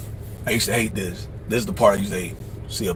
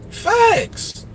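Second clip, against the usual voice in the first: high emphatic facts. Real, not acted. Emphatic agreement aside.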